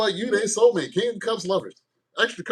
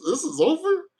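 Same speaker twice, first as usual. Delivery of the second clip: high hammed-up breakup cry; heartbroken Capricorn; 6 semitones higher than usual. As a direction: wail it like a soap opera exit. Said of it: "Capricorn says".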